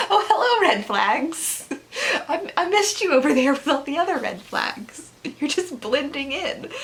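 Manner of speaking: high, playful greeting through giggles; hammed-up delighted hello; mock-sweet greeting. Acted mock greeting to red flags.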